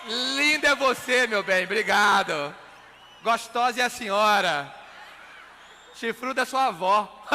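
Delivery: loud, playful laughing retorts; loud laughing banter. Real, not acted. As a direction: tease the audience back. Bantering with audience over noise.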